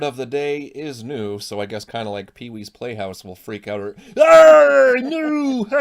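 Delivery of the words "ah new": high, loud squealing Pee-wee yell; Pee-wee Herman impression. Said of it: "That's as good of a Pee-wee as I can do".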